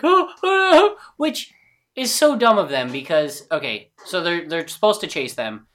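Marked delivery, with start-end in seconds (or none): 0.0-1.5 high, startled oh oh oh; high startled yelps. Retold or imitated. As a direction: yelp in panic. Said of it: "there's like".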